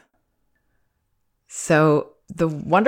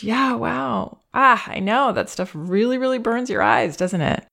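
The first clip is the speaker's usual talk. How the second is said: put-on higher, feeling it with the child; really see his pain and say so; heartfelt empathizing parent; empathic acknowledgement; 7 semitones higher than usual. Shown as a demonstration. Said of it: "we should ideally be able to acknowledge".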